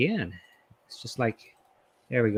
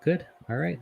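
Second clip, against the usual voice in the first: ordinary talk, nothing marked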